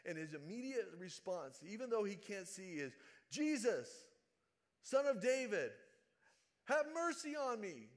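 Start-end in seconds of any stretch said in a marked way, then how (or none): 3.3-7.9 high, loud desperate calling out; a blind beggar yelling for help; loud cry for mercy. Retold or imitated. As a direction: cry out over the crowd. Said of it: "it's so loud and so obnoxious".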